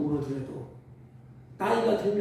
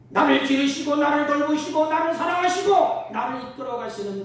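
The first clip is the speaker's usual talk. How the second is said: high, loud pulpit proclamation; rousing sermon shout; impassioned preaching; 9 semitones higher, 11 dB louder than usual. Acted. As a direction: drive the list out at full voice. Preaches at full force.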